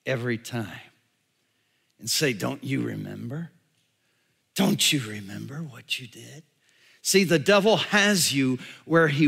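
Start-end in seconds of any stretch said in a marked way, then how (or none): 2.3-6.4 low, soft accusing reminder; quiet taunt. Retold or imitated. Devil reminds a sinner of past guilt, quiet taunt. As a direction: needle them softly, voice dropped low.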